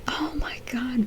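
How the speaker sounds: breathless whisper; whispered oh my God. Real, not acted. The vlogger gossips about the twist in a whisper. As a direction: hush it like juicy gossip.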